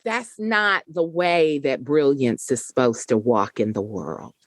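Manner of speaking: ordinary talk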